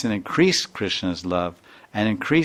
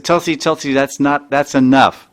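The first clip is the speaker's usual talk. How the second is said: high, loud pleading rebuke; urgent scolding; 4 semitones higher, 6 dB louder than usual. Retold or imitated. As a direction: cut her off and defend him. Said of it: "they said".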